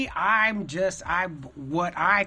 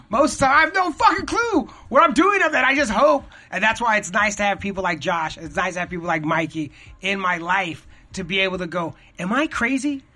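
higher, louder and fast, nearly shouting; excited rant; 4 semitones higher, 6 dB louder than usual. Real, not acted. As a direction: blurt out the confession in a rush, rising to a shout. Admitting he has no clue.

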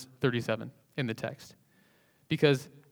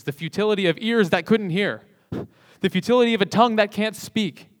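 rising, louder, higher preacher's cry; preaching at full force; 7 semitones higher, 7 dB louder than usual. Acted. Preacher pressing the congregation to feel the futility, full-force preaching. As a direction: build the sermon to its peak.

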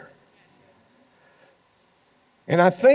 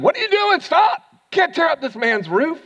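much higher, louder outraged yell; mock angry shout; 5 dB louder than usual. Homeowner yelling at the friends tearing up his roof, outraged. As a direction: yell at them to stop wrecking the roof.

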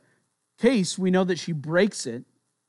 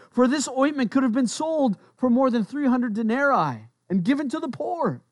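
high-pitched indignant reading; acted reproach, pitched well up; scolding; 7 semitones higher than usual. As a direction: voice the disciples' righteous objection. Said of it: "what is the reason for their scolding".

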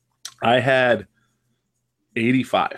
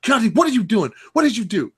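higher, mock yelling dad; a hint of a dad yelling into the phone; imitating angry dad; 10 semitones higher than usual. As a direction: play the startled father shouting at his son. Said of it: "he starts yelling at Keontae".

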